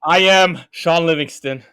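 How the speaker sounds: loud, slow, laughing declaration; triumphant answer. Real, not acted. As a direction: announce it like a winner. Confident final answer, laughing.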